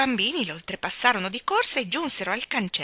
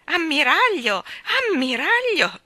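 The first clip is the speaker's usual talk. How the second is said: much higher, louder scandalised cry; lady covering her ears, crying out; shocked cry; 10 semitones higher, 7 dB louder, slower than usual. A narrator's reading. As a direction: shriek at him to stop. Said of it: "gridò la signorina Lark".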